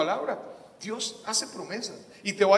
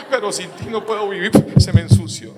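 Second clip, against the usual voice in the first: louder, higher plea; pleading outburst; 6 semitones higher, 9 dB louder than usual. Retold or imitated. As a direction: cry out that you can't live without Him. Retold plea to the Bible. (suplicando con fuerza)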